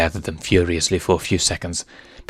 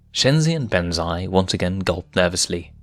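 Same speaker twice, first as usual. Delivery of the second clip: ordinary delivery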